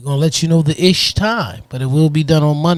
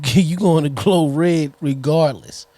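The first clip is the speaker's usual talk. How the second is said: normal talking voice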